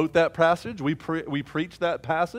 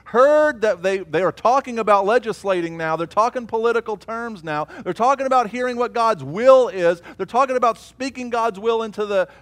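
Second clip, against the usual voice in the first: louder and higher, building to a cry; fiery preaching; 8 semitones higher, 8 dB louder than usual. Acted. Preacher rousing the congregation against the enemy, full force. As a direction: preach it with mounting fire.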